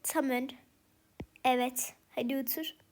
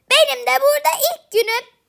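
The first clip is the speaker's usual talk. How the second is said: said again louder and higher, with "Benim" stressed; a much louder, higher repeat of "Benim de burada ilk günüm"; louder, higher retake; 9 semitones higher, 10 dB louder than usual. Shown as a demonstration. (daha yüksek ve tiz tekrar)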